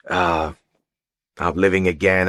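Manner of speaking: ordinary talk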